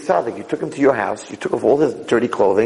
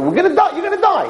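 emphatic retort; faster than usual. Retold or imitated. Rabbi countering the man who loves water, high emphatic retort. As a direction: voice leaps high on a blunt warning.

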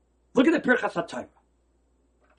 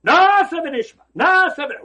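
proclaiming; 11 semitones higher, 10 dB louder than usual. Retold or imitated. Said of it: "Klal Yisrael, what they say?".

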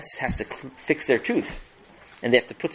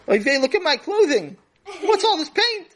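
high, loud, shocked mother; 4 dB louder than usual. Acted. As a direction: shriek at the ruined clothes. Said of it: "And then she stood up".

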